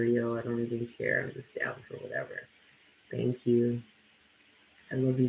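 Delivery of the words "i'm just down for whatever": I'm just down for whatever whispered, much softer and lower. Shown as a demonstration. Repeating her stance to viewers, whispered. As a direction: breathe it out as a whisper.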